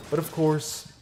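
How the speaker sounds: high, drawn-out "course"; dramatic high "of course". Shown as a demonstration. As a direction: raise the pitch on "course" for suspense. Dramatic turn in the story.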